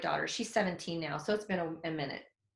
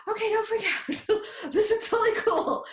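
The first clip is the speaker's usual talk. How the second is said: much higher, louder, laughing excitement; giddy nervous self-reassurance; 13 semitones higher, 5 dB louder than usual. Retold or imitated. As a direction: talk yourself calm while giggling. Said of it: "I was like".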